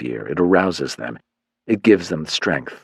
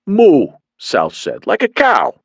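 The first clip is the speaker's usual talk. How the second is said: much higher, louder dim-witted thug; a dim goon proud of his joke; dopey goon; 10 semitones higher, 5 dB louder, slower than usual. Acted. Sal explains moo to his boss, high dopey goon.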